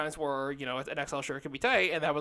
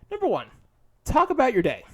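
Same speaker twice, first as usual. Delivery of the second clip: high, loud presenter announcement; mock list announcement; 9 semitones higher, 8 dB louder than usual. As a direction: announce it like a big countdown item. Announces list item in put-on voice.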